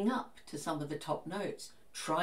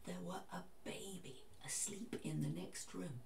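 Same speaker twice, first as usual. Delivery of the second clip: put-on soft whisper, careful not to wake a baby; hushed whisper; 13 dB quieter than usual. Shown as a demonstration. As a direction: drop to a hushed, breath-only voice. Illustrating singing beside sleeping baby.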